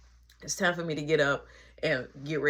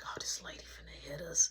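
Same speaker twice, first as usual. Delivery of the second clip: whispered in fear; a scared whisper to your brother; whispered aside; 3 semitones lower, 10 dB quieter than usual. Retold or imitated. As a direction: hush it so mom can't hear. Scared aside before punishment.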